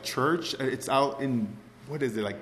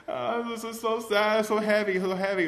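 acted tearful sadness; 7 semitones higher than usual. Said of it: "And I'm so sad, I'm crying".

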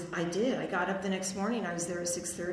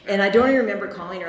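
excited; 11 dB louder, faster than usual. Real, not acted. Excited telling, loud and fast.

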